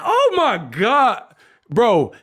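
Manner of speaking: high, drawn-out outburst of disbelief; floored by what was just read; stunned exclamation. Real, not acted. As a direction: cut yourself off with a high, stretched exclamation. Reacting to a viewer's comment.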